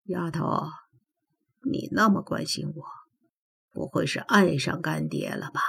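soft, lightly teasing godfather; mildly cheeky teasing. A narrator's reading. Godfather teases his goddaughter about loving him, softly cheeky. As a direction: tease her under your breath, a touch cheeky. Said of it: "北冥坤嬉皮笑脸地问".

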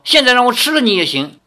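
fast, loud, high-pitched retort; hammed-up threatening grandmother; comic threat. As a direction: snap back with a mock threat.

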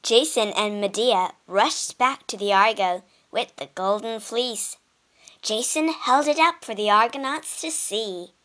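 ordinary delivery

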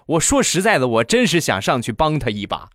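normal talking voice

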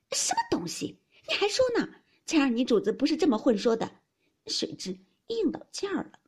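high, loud outburst of scorn; offended maid's sharp rebuke; indignant spitting retort. A narrator's reading. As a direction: spit it back indignantly. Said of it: "鸳鸯啐道".

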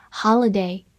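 "holiday" in an American accent; American pronunciation. Shown as a demonstration. Teacher showing learners the word "holiday", American pronunciation. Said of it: "美式发音".

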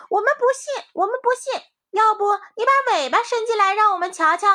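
very high, loud challenge; defiant rabbits. A narrator's reading. Rabbits dare the wolf to show his tail, high and loud.

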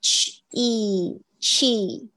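chi split into ch and ee; teacher isolating ch, then a long ee; long ee in chi broken down. Shown as a demonstration. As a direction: stretch out the ee sound in chi. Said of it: "这个要特别注意一下你的那个".

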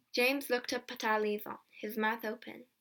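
ordinary delivery

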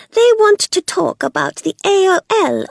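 high, loud sneering woman; mocking Belle. A narrator's reading.

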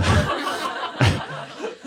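short breathy laugh to oneself; unvoiced chuckling laugh. Real, not acted. Laughs after retelling the brother's lesson.

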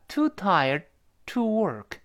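"too tired", stop on the held d, then "to work"; pause demo. Shown as a demonstration. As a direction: hold after "tired" before releasing "to work". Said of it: "舌尖顶住了吧？停一下".

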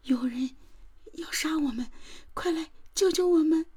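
high-pitched, frightened, urgent hush; hushed, trembling plea. A narrator's reading. Woman secretly calling police as killer strikes, hushed and trembling. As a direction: plead for rescue in a high, trembling undertone. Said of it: "刻意压低声音，颤抖地说道".

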